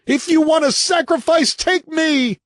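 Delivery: very high, loud pleading scream; shrieking plea. Acted. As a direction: beg at full volume, voice breaking. Husband offers himself, still shrieking.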